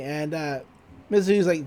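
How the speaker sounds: usual speaking voice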